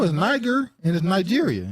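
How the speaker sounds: high-pitched correction, Niger with hard g, Nigeria with "nigh"; insisting on NIGH-ger. Shown as a demonstration. Host correcting co-host, Niger and Nigeria both starting "nigh". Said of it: "That's how you say it".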